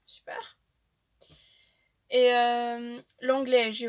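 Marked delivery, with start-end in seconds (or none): 0.1-0.6 a dry, sarcastic "super"; sarcastic aside. Real, not acted. Complaining about learning medical terms in German, sarcastic mutter. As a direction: mutter it under your breath, unimpressed.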